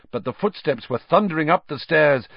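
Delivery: plain reading voice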